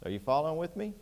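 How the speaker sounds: normal talking voice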